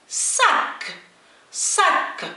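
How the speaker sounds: high-pitched teacher's model of "صاك" with a clear S; S sound demo. Shown as a demonstration. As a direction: say "صاك" with a plain hissed S at the start. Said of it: "يعني ننطقه مباشرة س".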